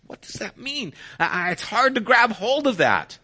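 high-pitched, incredulous objection; baffled challenge. Acted. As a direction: press the complaint as a puzzled outsider. Voicing baffled, challenging outsiders.

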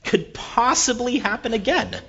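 high, loud, scoffing; dismissive kid. Retold or imitated. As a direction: scoff at the warning like a cocky kid. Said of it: "my response was".

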